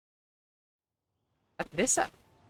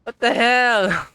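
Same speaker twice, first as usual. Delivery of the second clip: exasperated; 5 semitones higher, 5 dB louder, much slower than usual. Real, not acted. Echoing friend's outburst, exaggerated.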